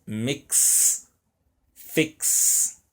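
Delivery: "Mix", "Fix" with the final X drawn into a hissed K-S; X pronounced as KS. Shown as a demonstration. Teacher shows students that X sounds like two consonants, X pronounced as KS. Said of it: "X imita o som de duas consoantes, o K e o S".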